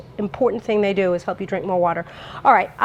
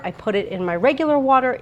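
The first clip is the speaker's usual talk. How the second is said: normal talking voice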